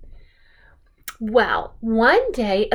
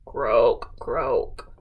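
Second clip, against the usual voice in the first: very low, croaky frog call; deep mock frog croak; 5 dB quieter than usual. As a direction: drop way down and croak like a bullfrog. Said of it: "Or some frogs say".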